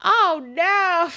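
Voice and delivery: big, high, drawn-out exclamation; loud dismay. Real, not acted. Player reacting to a character's insult in game, loud dismay. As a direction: cry out in amused horror. Mock outrage after the insult.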